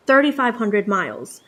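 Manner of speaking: normal talking voice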